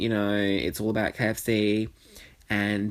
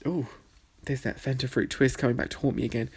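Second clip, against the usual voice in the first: ordinary delivery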